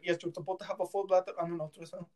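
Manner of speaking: quick, badly faked Indian accent; horrible Indian accent. Doctor correcting a patient in the ultrasound room, bad Indian accent. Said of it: "That was a horrible Indian accent".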